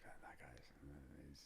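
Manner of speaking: breathless awed whisper; hushed whisper. Retold or imitated. Watching him fight on UFC, hushed whisper. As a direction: whisper it in disbelief.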